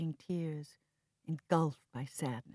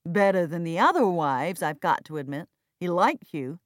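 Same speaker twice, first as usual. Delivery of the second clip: sneering; 3 semitones higher, 9 dB louder than usual. Acted. Jack mocking Viv's devotion to their father, sneering. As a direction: needle her with scorn.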